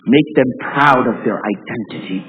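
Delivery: ordinary delivery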